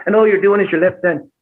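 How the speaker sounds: Irish lilt pushed to the front of the mouth; Irish accent. Shown as a demonstration. Teaching how British shifts to Irish, Irish accent. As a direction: restrict the lips into an Irish accent. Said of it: "it becomes Irish".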